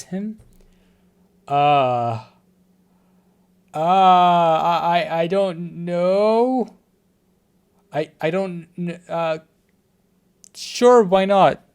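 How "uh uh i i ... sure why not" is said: flustered. Real, not acted. Flustered choosing to kiss.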